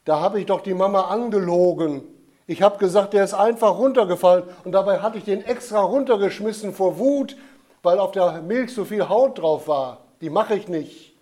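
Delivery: put-on loud, high, agitated child confession; confessing boy. A narrator's reading. Michael confessing his lie to the Jesus child, loud and upset. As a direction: spill the guilty truth out in a rush.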